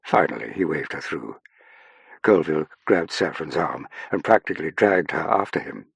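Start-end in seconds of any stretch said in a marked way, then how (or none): none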